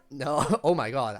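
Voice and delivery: ordinary talk, nothing marked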